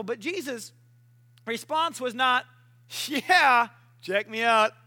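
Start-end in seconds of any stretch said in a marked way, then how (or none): 3.1-4.7 loud show-off swagger; acted cocky exclamation; mock boasting. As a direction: brag it playfully. Mock boastful response of Jesus.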